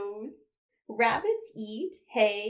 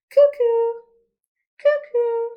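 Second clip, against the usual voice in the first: high loud cuckoo; shrill-pitched loud cuckoo call; 12 semitones higher, 11 dB louder than usual. Shown as a demonstration. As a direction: call out like a clock bird. Cuckoo clock call in song.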